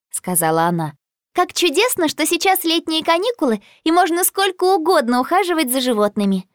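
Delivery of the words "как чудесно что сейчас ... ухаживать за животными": put-on high, eager girl's voice; delighted girl character. A narrator's reading. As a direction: voice an excited schoolgirl, pitched well up. Narrator voices delighted Jess.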